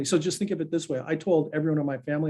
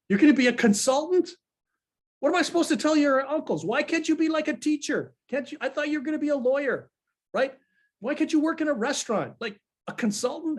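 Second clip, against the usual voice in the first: much higher imitated mother, alarmed and disbelieving; shocked Greek mother; 11 semitones higher than usual. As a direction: play the Greek mom who can't believe her son's career choice. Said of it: "And then my mother goes".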